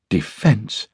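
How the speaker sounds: high, mildly scornful query; slight sniff. A narrator's reading. Wife dismisses the woman's defence, with a sniff. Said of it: "said Barbara with a slight sniff".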